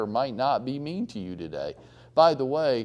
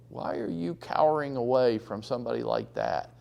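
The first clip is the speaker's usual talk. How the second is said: ordinary talk, nothing marked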